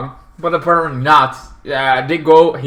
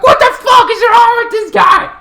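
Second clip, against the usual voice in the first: very high screaming outburst; outraged shout; 9 dB louder than usual. Acted. Driver harassed by a truck, outraged high shout. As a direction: shriek it in exasperated disbelief.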